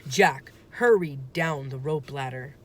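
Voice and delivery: ordinary delivery, nothing marked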